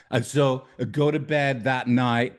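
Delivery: usual speaking voice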